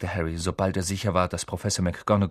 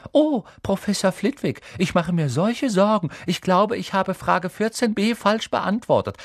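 mocking high-pitched girl's voice; high, fretful mimicry of a classmate; imitating Hermine; 11 semitones higher, 4 dB louder than usual. A narrator's reading. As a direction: parody an anxious swot, pitched way up. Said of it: "Er ahmte Hermines Stimme nach".

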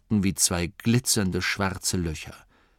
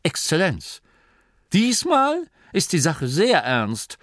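higher, louder formal insistence; stiffly; 9 semitones higher, 4 dB louder than usual. Acted. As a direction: say it stiffly and gravely. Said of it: "sagte Dorcas steif".

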